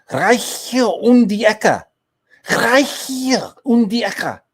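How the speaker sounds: slow, loud, high mock German; exaggerated German accent. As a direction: belt it out in a drawn-out, hammed-up German accent. Hamming up German phrase.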